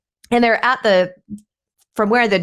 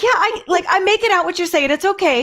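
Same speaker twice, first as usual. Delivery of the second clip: much higher, gently reassuring reply; 11 semitones higher than usual. Retold or imitated. Answering the sick neighbor on the phone, high and soothing. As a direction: keep it high and sweet, humoring him. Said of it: "And I'm like".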